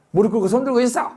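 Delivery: retold parental command; barked order. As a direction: order them like a strict dad. Father orders kids to kneel.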